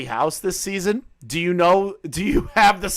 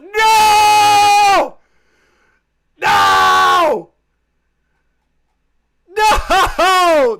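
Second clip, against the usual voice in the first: very high, loud wail of no; anguished no; 11 dB louder, much slower than usual. Pretend. Streamer mock-despairs at deleted messages, wailing high. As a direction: wail it like all is lost.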